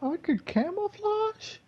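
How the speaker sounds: very high, excited squeal; gleeful. Retold or imitated. Gamer thrilled he can camouflage, very high. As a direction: squeal with delight at the new trick. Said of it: "at this point I was like".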